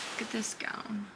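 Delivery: hushed whisper of awe. Real, not acted. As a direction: breathe it out in a whisper. Quiet awe in the showroom.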